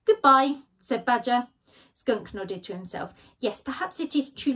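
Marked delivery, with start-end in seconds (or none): 0.1-0.6 loud, curt dismissal; curt goodbye. Acted. Badger dismissing Skunk, loud and curt. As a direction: shut him down.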